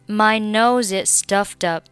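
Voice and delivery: ordinary delivery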